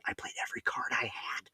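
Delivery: soft whispered confession; whispered aside. Real, not acted. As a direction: whisper it like a secret. Aside about avoiding arrest.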